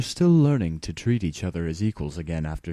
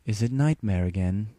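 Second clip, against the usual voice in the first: plain reading voice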